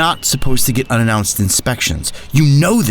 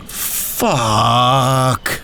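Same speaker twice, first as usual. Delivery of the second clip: groaned curse. Acted. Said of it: "groaned loudly".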